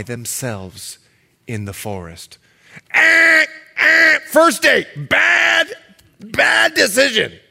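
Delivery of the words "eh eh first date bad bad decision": loud, high alarmed shout; shouted warning. Real, not acted. Preacher shouting a warning about the first date. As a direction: yell the warning at the crowd.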